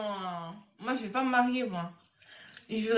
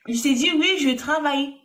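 raised voice; 4 semitones higher, 6 dB louder than usual. Real, not acted. Asked to speak louder on call.

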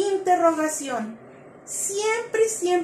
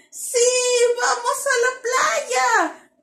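higher, slow, thrilled cheer; joyful exclamation; 7 semitones higher, 3 dB louder, slower than usual. Shown as a demonstration. Teacher showing kids a happy exclamation, higher and thrilled. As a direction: cheer it out like the beach trip is on. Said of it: "de asombro, de alegría".

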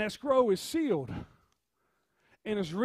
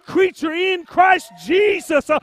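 full-force preaching; 6 semitones higher, 14 dB louder than usual. Real, not acted. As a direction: build to high, slow, shouted declarations. Preaches, building to full shout.

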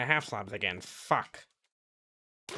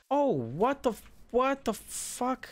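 shocked yelp; 12 semitones higher, slower than usual. Real, not acted. Shocked at burning trees.

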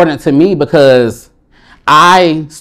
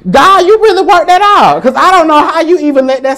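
much higher, laughing praise; thanking God; 12 semitones higher than usual. Retold or imitated. Recalling the college transfer, high laughing praise to God. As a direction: marvel at getting away with it. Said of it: "but I said".

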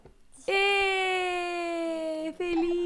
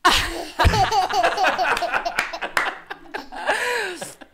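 long helpless laugh; real laughing fit; burst of laughter; 4 semitones higher, 4 dB louder than usual. Real, not acted. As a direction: laugh out loud, can't hold it. Parent laughing at baby.

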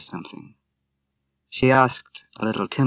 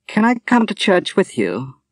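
put-on young woman's voice; 10 semitones higher, 5 dB louder than usual. A narrator's reading. Moira voiced in read novel.

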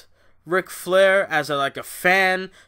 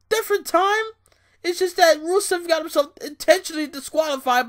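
exasperated outburst; 13 semitones higher, 5 dB louder than usual. Real, not acted. Vlogger ranting at a repeated match, high exasperated yelling. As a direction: howl in disbelief at the rerun.

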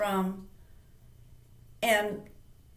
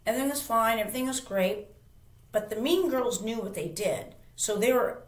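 ordinary talk, nothing marked

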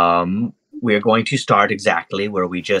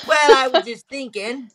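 high, showy monkey voice; cocky small creature, pitched up; boastful little monkey; 9 semitones higher than usual. Acted. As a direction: talk yourself up to your crush.

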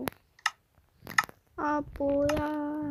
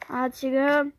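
ordinary delivery

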